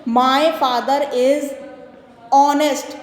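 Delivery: very slow sentence reading; slow teacher read-aloud; slow dictation. Shown as a demonstration. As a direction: read word by word to the class. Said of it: "ठीक है".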